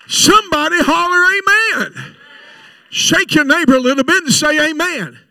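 loud call for an amen; rally the room to answer; preacher's call. Acted. Preacher telling the congregation to shout amen.

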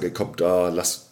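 usual speaking voice

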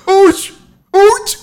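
high loud cartoon grunt; Homer Simpson grunt. Trying Homer's voice for his cohost, loud high grunt. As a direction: bark it out like Homer. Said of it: "¿Cómo sería la voz de Homero Simpson?".

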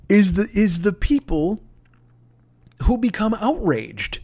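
ordinary talk, nothing marked

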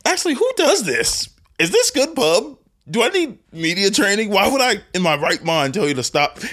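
high-pitched mock disbelief; exaggerated high self-scolding; mock outrage. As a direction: question yourself in a squeaky, incredulous voice.

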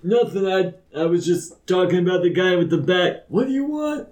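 high, nervous denial; high flustered NPC. Acted. Startled man brushes off his friends, high and flustered. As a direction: pitch it up and cover awkwardly.

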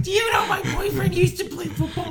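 high, shouted mocking heckler voice; mock heckler girlfriend. Girlfriend heckling the comic, high mocking shout. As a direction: shriek it like a pushy girlfriend.

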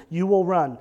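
ordinary delivery

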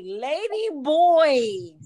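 put-on drawn-out, slow teasing "Lady boy"; slow taunt. Comedian slowly taunting the lady boy. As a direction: stretch it out to needle her.

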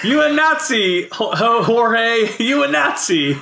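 high, slow drawn-out taunt; playful high jeer; mock accusation. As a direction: stretch it into a silly, high-pitched accusation. Echoes the mock accusation, stretched out.

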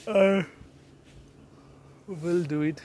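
words stretched by a yawn. Real, not acted. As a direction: talk through a yawn. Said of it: "I'm yawning a lot".

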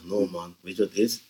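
low, very soft offhand mumble; nonchalant. Retold or imitated. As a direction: toss off the confession as if it were nothing. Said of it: "gewoon nonchalant". (achteloos en zacht gezegd)

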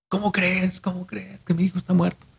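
high, hushed refusal to believe; stunned, breath pulled back, pitched high; shocked disbelief. Retold or imitated. Father hears from his wife the son is dead, high hushed disbelief.

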